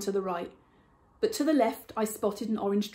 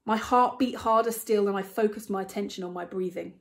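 ordinary delivery, nothing marked

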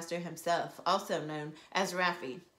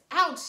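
ouch; 9 semitones higher, 9 dB louder than usual. Acted. Earphone snaps back.

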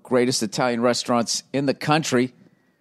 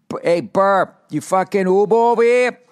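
put-on Boston accent; 10 semitones higher, 5 dB louder than usual. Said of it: "the club owner says to me".

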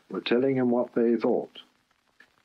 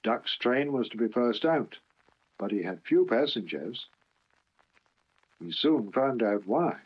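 ordinary delivery, nothing marked

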